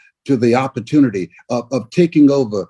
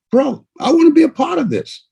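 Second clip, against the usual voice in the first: higher, eager declaration; 11 semitones higher, faster than usual. Retold or imitated. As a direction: say it like you really want in. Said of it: "You made a decision".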